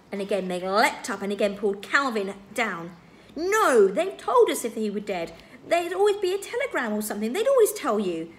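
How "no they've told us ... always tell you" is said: loud, high outburst; upset protest. A narrator's reading. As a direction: cry out in indignant denial. Meg leaps up, protests upset.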